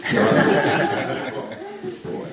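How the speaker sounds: slow, whispered тераплю through a laugh. Shown as a demonstration. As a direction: breathe out тераплю slowly, laughing. (медленно, шёпотом, со смехом)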